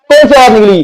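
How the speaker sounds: ordinary talk, nothing marked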